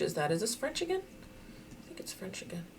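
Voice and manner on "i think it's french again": whispered aside. Real, not acted. Muttering her guess to herself in a whisper. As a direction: drop to a whisper.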